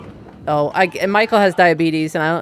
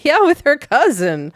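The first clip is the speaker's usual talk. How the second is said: laughing; 9 semitones higher, 4 dB louder than usual. Pretend. Laughing mock reply to Kay.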